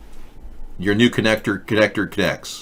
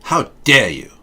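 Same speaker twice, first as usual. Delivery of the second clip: Greta impression. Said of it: "Everything she says is angry".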